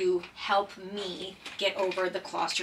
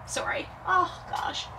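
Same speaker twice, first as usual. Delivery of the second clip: tearful laugh; 9 semitones higher than usual. Real, not acted. Breaking down mid-sentence.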